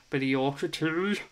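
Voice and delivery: a quick, half-hearted Yorkshire twang on the tea; put-on Yorkshire accent. Streamer answering a viewer's tea comment in a mock Yorkshire accent. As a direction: slip into a mock Yorkshire accent for one line. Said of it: "Don't know what the fuck accent that was".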